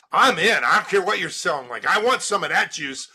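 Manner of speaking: loud, high, fast excitement; hammed-up, all in on the spot; excited. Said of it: "they're like".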